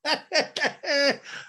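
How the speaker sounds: high giggle; stuttering high-pitched laugh; real laugh. Real, not acted. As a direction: crack up after delivering the joke. Host laughs at retold line.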